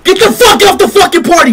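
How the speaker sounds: ordinary talk, nothing marked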